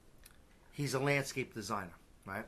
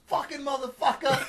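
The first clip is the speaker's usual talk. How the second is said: high-pitched mock child's outburst; imitating a cursing little girl; 11 semitones higher, 4 dB louder than usual. Quoting buddy's daughter swearing, mock child voice. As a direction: pipe it up high like a small kid.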